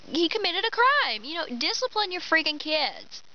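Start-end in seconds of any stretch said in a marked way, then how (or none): none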